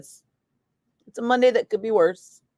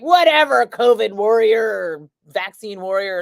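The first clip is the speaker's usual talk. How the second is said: mock the angry online guy; mocking online troll; 5 dB louder than usual. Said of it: "and he was like".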